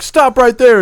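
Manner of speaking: high, loud stop order; mock police shout; cop shout. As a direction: yell it like a cop. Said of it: "They'll be like".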